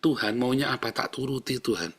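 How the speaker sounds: submissive prayer, settling downward; resigned surrender. Shown as a demonstration. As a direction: yield to God, without protest. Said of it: "Pasrah, saudara". (doa pasrah dan menurut)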